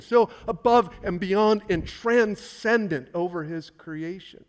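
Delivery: high, loud sermon delivery; fiery preaching. Acted. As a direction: preach it with mounting fire. Preaching at full force.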